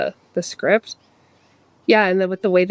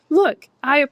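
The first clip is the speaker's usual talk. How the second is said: mom voice; 7 semitones higher, 5 dB louder than usual. Retold or imitated. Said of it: "she's like".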